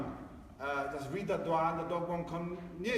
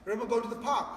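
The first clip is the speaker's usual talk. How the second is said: ordinary talk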